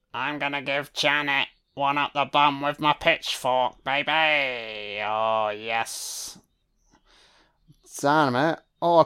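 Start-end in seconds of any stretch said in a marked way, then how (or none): 0.1-6.4 softer, leering mock villain; lightly voiced lecherous handyman; creepy priest voice. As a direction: purr it like a sleazy butler.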